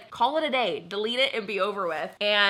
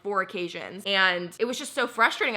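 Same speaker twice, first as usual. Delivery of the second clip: normal talking voice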